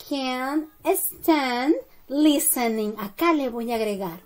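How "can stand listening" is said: very slow, drawn-out syllables; teacher dictating at a crawl; slow model phrase. Shown as a demonstration. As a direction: stretch every syllable for learners.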